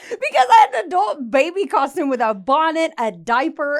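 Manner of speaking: loud words through laughter; laughing. Real, not acted. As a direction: laugh while you talk. Laughing while telling.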